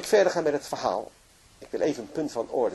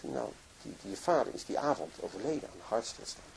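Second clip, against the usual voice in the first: hushed; 4 semitones lower, 10 dB quieter than usual. Retold or imitated. Friend breaks death news.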